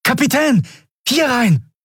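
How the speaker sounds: high, urgent shout; calling out. A narrator's reading. Miko waving his captain inside, urgent shouted call. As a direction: call them over from a doorway. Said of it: "ertönte Mikos Stimme hinter ihnen".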